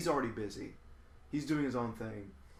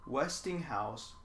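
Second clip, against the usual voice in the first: slow emphasis; much slower than usual. Real, not acted. Naming worst brand.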